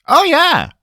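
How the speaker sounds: a cheerful, eager yes. Acted. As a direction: chirp it out, pleased. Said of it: "said cheerfully".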